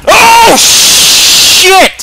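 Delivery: sudden shriek of fright; startled scream. Real, not acted. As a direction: scream as something jumps out. Sudden jump scare.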